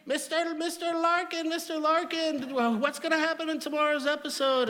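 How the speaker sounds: high, squealing fan voice; excited maids. Retold or imitated. Hotel maids chasing the TV star, squealing high and excited. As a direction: squeal like starstruck fans chasing him. Fans of the show calling out.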